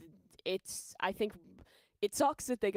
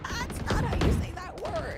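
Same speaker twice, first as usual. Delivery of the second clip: incredulous; 10 semitones higher, slower than usual. Retold or imitated. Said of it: "and I was like".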